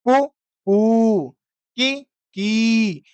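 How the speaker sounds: कु short and कू drawn out long, की short then की long; short versus long vowel. Shown as a demonstration. Teacher contrasts short and long vowels, very slow and drawn out. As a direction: stretch the long vowel, clip the short one. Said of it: "उसको दीर्घ लंबा बोलेंगे ... उसको थोड़ा धीरे बोलेंगे".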